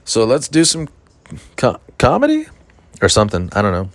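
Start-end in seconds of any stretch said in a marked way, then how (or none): 0.0-2.5 high, strained cheery emcee voice; awkward mock emcee. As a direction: force a cheery comedy intro. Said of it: "I'm like".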